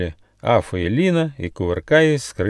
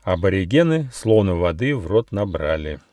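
ordinary delivery, nothing marked

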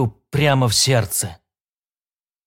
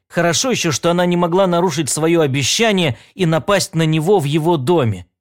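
ordinary delivery

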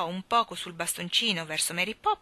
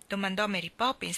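ordinary delivery

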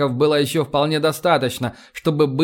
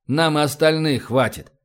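usual speaking voice

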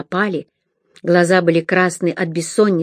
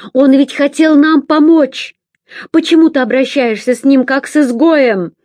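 high, loud protest; shouting; 7 semitones higher, 5 dB louder than usual. A narrator's reading. Girl shouting at brother for mistreating a friend. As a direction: plead loudly in his defence. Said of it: "кричал Рен".

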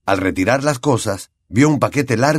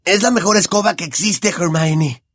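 put-on higher boyish voice, fed up; exasperated; 8 semitones higher than usual. Ron exasperated with Hermione about the broom, sighing. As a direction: sigh it at her, exasperated. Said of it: "Ron suspiró, exasperado".

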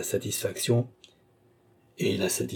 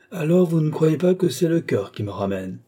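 raised, higher indignant cry; exclaiming; 7 semitones higher, 4 dB louder than usual. A narrator's reading. The duke cries out to the countess in mock protest. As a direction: protest loudly, feigning hurt. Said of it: "s'écria Richelieu".

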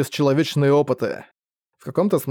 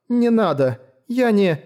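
put-on girl voice; 7 semitones higher, slower than usual. Girl refuses, shaking head.

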